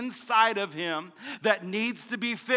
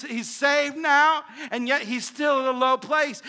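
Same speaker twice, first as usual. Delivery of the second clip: loud, higher, impassioned preaching; preaching at full force; 5 semitones higher, 5 dB louder than usual. Real, not acted. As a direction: push the message hard, almost singing.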